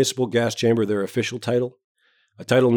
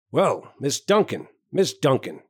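slow, higher-pitched hearty greeting; booming, self-important; 5 semitones higher, slower than usual. A narrator's reading. Jovial doctor greeting Miss Duncan in the waiting room, booming and self-important. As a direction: greet her with a swooping, important air. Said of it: "he boomed with importance".

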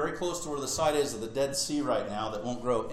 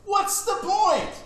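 much higher, loud, exasperated rant that slows right down on the last line; fed-up whining rant, pitched way up; mad Jonah; 10 semitones higher, 6 dB louder than usual. Retold or imitated. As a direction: play an indignant prophet venting at God. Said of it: "But he was mad".

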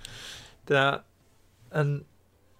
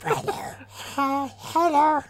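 high squawky duck try; a lightly voiced cartoon duck; Donald Duck attempt; 12 semitones higher, 4 dB quieter, slower than usual. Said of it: "I'll just do zombie Donald Duck".

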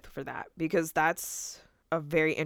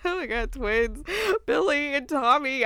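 excited gasp; 8 semitones higher, 5 dB louder than usual. Retold or imitated. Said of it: "I was like".

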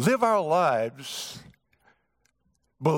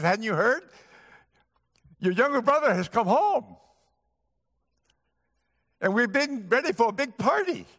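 eager servant; 7 semitones higher than usual. Acted. Servant voiced in retold story.

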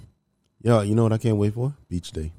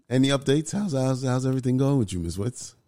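ordinary talk

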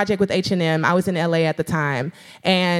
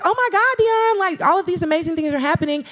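gushing fans; 10 semitones higher than usual. Retold or imitated. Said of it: "people were like".

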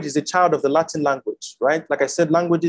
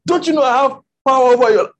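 Pilate threatening; 7 semitones higher, 5 dB louder than usual. Retold or imitated. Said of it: "He said".

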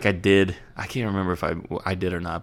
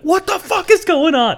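much higher, slow, freaked-out squeal; mock terrified; 4 dB louder than usual. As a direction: replay a kid's panic at three in the morning. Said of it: "I'm like".

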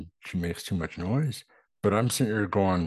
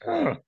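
squeaky high exasperated ugh; high disgusted ugh. Retold or imitated. Viewer reacts to the blunder, high frustrated ugh.

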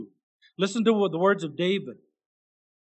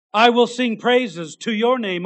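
ringing praise; 5 semitones higher, 7 dB louder than usual. Acted. Verse sung out in praise.

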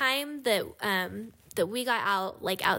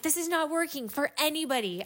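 higher-pitched mild scolding; retold yelling; 7 semitones higher than usual. Woman retelling her lecture to squabbling kids, mild mock yell. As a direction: plead firmly with a rowdy group, volume held. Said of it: "yelling at them".

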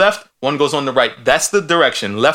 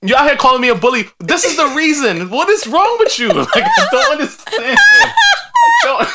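laughing mock offence; 8 semitones higher than usual. Real, not acted. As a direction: play offended while cracking up.

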